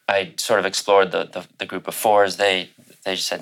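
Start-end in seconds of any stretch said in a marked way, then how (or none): none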